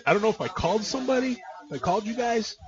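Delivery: usual speaking voice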